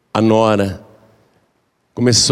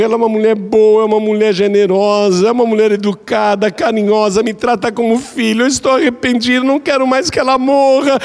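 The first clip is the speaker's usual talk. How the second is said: remorseful pleading; 10 semitones higher than usual. Acted. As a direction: beg for the antidote, desperate. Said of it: "chegou lá arrependida".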